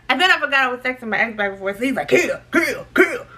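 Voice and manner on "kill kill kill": mock voice. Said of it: "in this voice".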